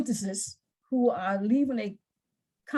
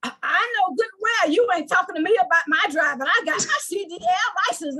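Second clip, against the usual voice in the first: louder, higher, offended snap back; indignant retort; 6 semitones higher, 3 dB louder than usual. Retold or imitated. Passenger bristling at a comment on her driving, offended. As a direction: bristle at being told how to drive. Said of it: "That's not what happened".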